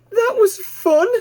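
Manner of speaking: put-on high, bright young piglet voice, thrilled; piglet Babe, delighted. A narrator's reading. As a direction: squeal it out like an excited little pig.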